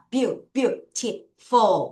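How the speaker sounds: very slow, broken into syllables; teacher modelling beautiful slowly; slow pronunciation. Shown as a demonstration. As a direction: model the word beautiful piece by piece. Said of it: "같이 발음해 볼게요".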